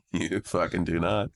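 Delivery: slow, deadpan scorn; sarcastic retort. Retold or imitated. Comic mocking a customer service claim, slow deadpan contempt. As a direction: drag it out with disbelief.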